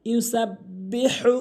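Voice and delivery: slow, drawn-out chanted recitation; Quran recitation. Shown as a demonstration. Teacher reciting the verse slowly for students.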